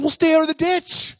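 high, loud mock-stern order; scolding grandma. Grandma orders the boy out of the ditch, scolding. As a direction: lay down the law as grandma.

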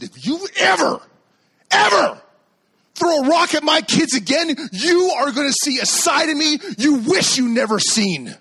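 high, loud shouted warning; furious threat. Retold or imitated. Dad warning kid who threw rock, furious. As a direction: hammer out a protective father's threat.

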